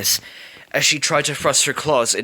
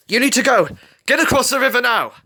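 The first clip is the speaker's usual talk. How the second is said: high, loud commanding shout; urgent order; 12 semitones higher, 6 dB louder than usual. A narrator's reading. Rebel sending Scarlet across the river, loud urgent order. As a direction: order them away fast. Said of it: "Rebel ordered".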